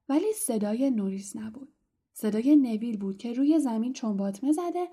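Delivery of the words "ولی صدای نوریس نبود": ordinary delivery, nothing marked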